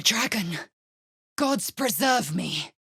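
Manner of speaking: panicked plea; frightened cry. Acted. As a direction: cry out in terror. Terrified villager cry.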